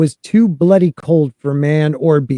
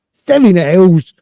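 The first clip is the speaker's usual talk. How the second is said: gruff character voice; 3 semitones higher, 6 dB louder, slower than usual. Acted. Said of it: "I can't do it".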